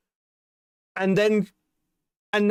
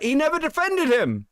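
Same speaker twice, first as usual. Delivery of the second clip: high, exasperated insistence; indignant protest; 9 semitones higher than usual. Real, not acted. Streamer insisting he never defended him, indignant. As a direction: voice climbs sharply in disbelief.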